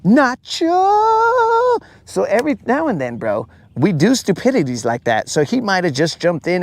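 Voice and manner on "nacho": much higher, strained Nacho Libre cry; high mock wrestler call of Nacho; Nacho Libre yell. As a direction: belt out Nacho like the movie wrestler. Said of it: "we quote Nacho Libre here".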